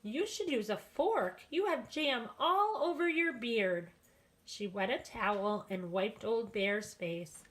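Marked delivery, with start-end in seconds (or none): 0.0-3.9 put-on old woman. A narrator's reading. Old woman scolds the bear gently.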